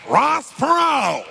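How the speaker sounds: high, drawn-out shout of a name; announcer shout. Real, not acted. Speaker hyping a third candidate to seminar crowd, drawn-out shout. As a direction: shout it out long, loud and high.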